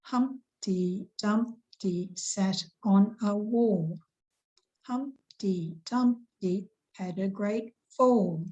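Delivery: slow, beat-marked reading of Humpty Dumpty; slow, rhythmic nursery-rhyme recital; metrical stress reading. Shown as a demonstration. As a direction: tap out each stressed syllable slowly. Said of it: "here's our stresses on the syllables".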